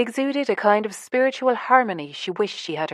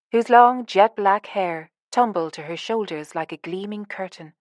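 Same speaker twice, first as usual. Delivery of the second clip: ordinary delivery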